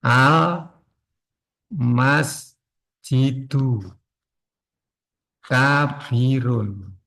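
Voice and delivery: very slow, word by word; slow dictation. Shown as a demonstration. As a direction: spell it out slowly for writing. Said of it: "Ini pelan-pelan aja ya".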